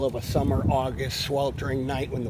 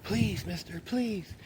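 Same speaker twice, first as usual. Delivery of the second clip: begging; 6 semitones higher, 5 dB quieter, slower than usual. Retold or imitated. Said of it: "the guy going".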